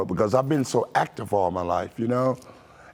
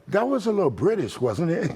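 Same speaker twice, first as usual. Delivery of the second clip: playful ribbing, pitched up; teasing; 6 semitones higher than usual. Retold or imitated. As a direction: needle him about sounding British. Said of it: "they tease me and go".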